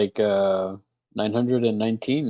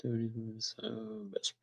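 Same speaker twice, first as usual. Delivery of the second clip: whisper; 17 dB quieter than usual. Real, not acted. Aside while film plays.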